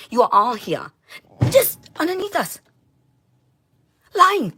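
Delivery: real rage, screaming at them; furious shout. Real, not acted. Ranting at everyone who wronged her, furious yelling.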